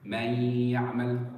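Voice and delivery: "Man ya'mal" with a long nasal y on one held note; held ghunna. Shown as a demonstration. Teacher showing students where time is spent, long nasal ya in "Man ya'mal". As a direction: merge the nun of "Man ya'mal" into the ya and hold it slowly. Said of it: "I made the ghunna, and I spent my emphasis".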